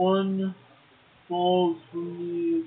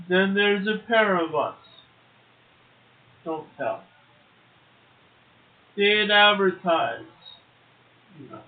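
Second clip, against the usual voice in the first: poem recital; 2 semitones higher, 3 dB louder than usual. Acted. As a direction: let each line hang before the next. Reads a Dickinson poem.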